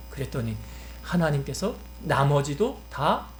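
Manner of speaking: ordinary talk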